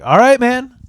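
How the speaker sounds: mock-innocent chirp to cover it up; talking to his brother, sing-song fake innocence.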